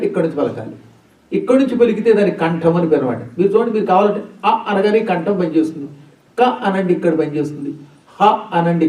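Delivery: normal talking voice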